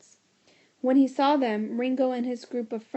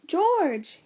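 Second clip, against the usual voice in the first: calling out; 7 semitones higher, 5 dB louder than usual. A narrator's reading. Calling from crowd.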